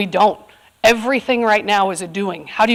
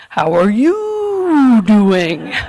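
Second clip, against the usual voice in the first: slow, drawn-out, louder toy voice; talking toy cow; 5 dB louder, much slower than usual. Retold or imitated. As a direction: stretch it out like a squeaky-clean talking toy. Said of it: "and the cow goes".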